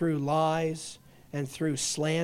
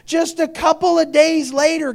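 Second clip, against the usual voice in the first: loud, higher pulpit cry; full-force preaching; 11 semitones higher, 11 dB louder than usual. Real, not acted. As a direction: hammer it home to the crowd. Same words preached at full force.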